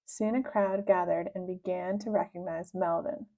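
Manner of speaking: usual speaking voice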